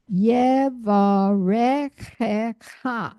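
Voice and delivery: Yevarechecha read slowly, syllable by syllable; slow careful reading. Shown as a demonstration. Teacher rereads slowly so students can follow. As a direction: draw out every syllable of Yevarechecha. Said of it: "look at it as I read it again".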